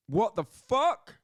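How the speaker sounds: loud, slow, high-pitched disbelief; drawn-out shocked cry of disbelief; stunned outburst. Real, not acted. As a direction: blurt it out like you can't believe your eyes. Stunned reaction to the knockout.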